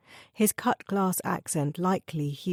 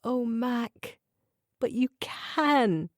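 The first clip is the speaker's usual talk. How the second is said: tearful plea; 6 semitones higher, much slower than usual. A narrator's reading. Wife gently urging her grieving husband, tearful and tender. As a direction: coax him tenderly through tears. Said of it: "Tears welled".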